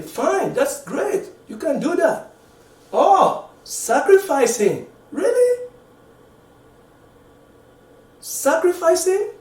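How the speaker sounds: high-pitched mock disbelief; incredulous. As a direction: climb high into an astonished, questioning protest. Mock disbelief at Solomon's punishment.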